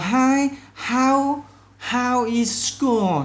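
loud, high, slow model greeting; upbeat greeting demo. Shown as a demonstration. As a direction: greet warmly and stretch it out. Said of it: "Đây, còn". (chào hỏi mẫu to, chậm, giọng cao)

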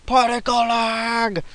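slow, high drawn-out call of particle lag; catchphrase shout. Pretend. Gamer calling out his lag catchphrase again, drawn-out high yell. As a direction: stretch it out like a silly battle cry.

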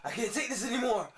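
usual speaking voice

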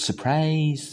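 lightly imitated TV drama line, drawn out; a hint of acted surprise, stretched clearly; คุณชายชาวี. Teacher quoting a TV drama character, lightly imitated clear drawn-out delivery. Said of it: "คำศัพท์ไหนพูดชัดมาก".

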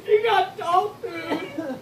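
high, slow, laughing through words; cracking up mid-sentence. Real, not acted. Friend finally gets dog food bean.

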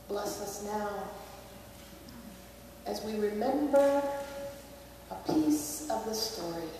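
soft, slow, hushed prayer voice. Acted. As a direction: pray quietly to God. Pastor closing an opening prayer.

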